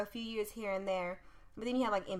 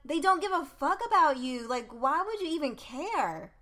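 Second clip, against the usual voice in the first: raised, higher and louder, indignant; heated rant; 8 semitones higher, 8 dB louder than usual. Real, not acted. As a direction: fire off outrage at the league. Heated rant about NFL hiding CTE.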